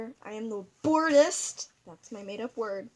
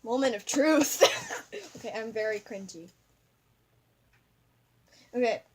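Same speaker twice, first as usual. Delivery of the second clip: usual speaking voice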